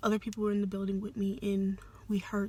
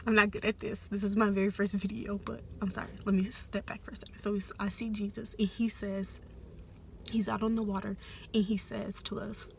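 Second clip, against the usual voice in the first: usual speaking voice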